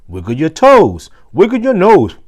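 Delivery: playful wiggle call. Acted. Teacher leads wiggle game.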